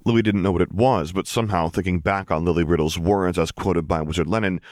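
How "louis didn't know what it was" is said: ordinary delivery, nothing marked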